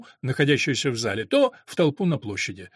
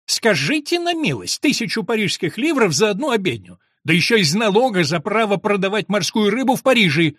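put-on high, louder old woman's indignant outburst; spiteful cry; 5 semitones higher, 4 dB louder than usual. A narrator's reading. Old woman protests the cost of one mass, crying spitefully. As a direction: rail indignantly in a raised voice. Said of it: "злобно крикнула какая-то старуха".

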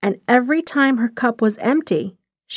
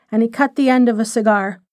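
plain reading voice

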